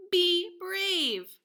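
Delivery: high, loud, punched "Be brave"; emphatic read-out. Shown as a demonstration. Teacher reads the author's dedication.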